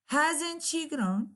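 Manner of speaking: slow "Hasn't she grown?" with Hasn't stressed and a falling end; exclamatory falling tune. Shown as a demonstration. Teacher repeats the exclamatory example, falling tune. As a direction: stretch it out and let it fall. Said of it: "there will be a falling instead of rising intonation".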